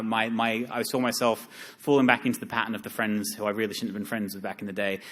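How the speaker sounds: usual speaking voice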